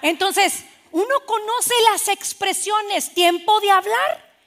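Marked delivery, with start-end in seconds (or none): 3.1-4.3 raised, slow, ringing call ending on a rise; high and loud, drawing the words out; loud speaking time. Shown as a demonstration. Preacher shows the congregation a time to speak, loudly.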